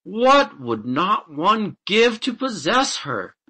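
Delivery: high, loud, drawn-out longing; slow, swooping rapture; smitten exclamation. A narrator's reading. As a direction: exclaim slowly, carried away with desire. Smitten farmer exclaims over princess.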